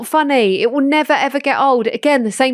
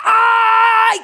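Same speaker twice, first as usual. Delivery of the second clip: exasperated shout; 11 semitones higher, 3 dB louder than usual. Real, not acted. Shouts at son.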